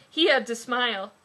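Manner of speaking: ordinary talk, nothing marked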